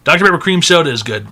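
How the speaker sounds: normal talking voice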